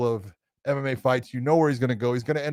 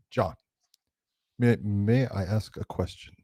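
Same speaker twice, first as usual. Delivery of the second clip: slow, measured, careful asking; quiet deliberate politeness with pauses; John Danaher; 3 semitones lower, 3 dB quieter than usual. Retold or imitated. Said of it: "that's the way he talks".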